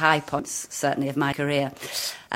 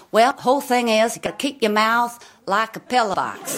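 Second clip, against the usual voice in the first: put-on Oklahoma prairie accent; 4 semitones higher than usual. Explaining the accent to a famous guest, broad prairie accent. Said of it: "How do you do the accent".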